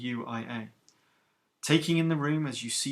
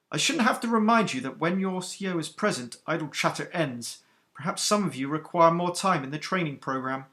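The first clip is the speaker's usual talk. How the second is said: stern captain, higher and louder than the narration; iron-voiced captain; 4 semitones higher, 5 dB louder than usual. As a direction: rebuke the recruits who kept chattering. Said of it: "the iron command in her voice".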